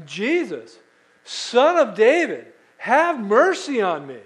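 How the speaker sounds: loud pleading cry. Retold or imitated. Woman crying out to Jesus, loud plea. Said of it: "She's yelling at him".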